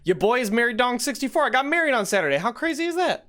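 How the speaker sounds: high, thrilled announcement; excited. Real, not acted. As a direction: burst out with happy news. Announces his wedding excitedly.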